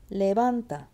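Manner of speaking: "Levanta" with weight on "van"; stress on VAN. Shown as a demonstration. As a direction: punch the middle syllable of "Levanta". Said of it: "It's on the van".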